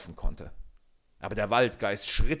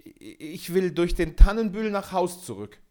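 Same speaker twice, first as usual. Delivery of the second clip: higher, louder, stuttering with fright; stammering fear; 8 semitones higher, 6 dB louder than usual. A narrator's reading. Peter answers the forest king, stuttering with fear. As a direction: blurt it out, shaking. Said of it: "aber heftig zitterte".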